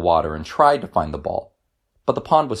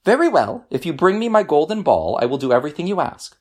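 high, quick false promise; princess pretending to agree; 8 semitones higher than usual. A narrator's reading. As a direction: rush through a fake yes. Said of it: "she said".

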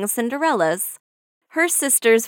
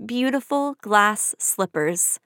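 ordinary delivery

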